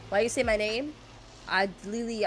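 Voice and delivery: normal talking voice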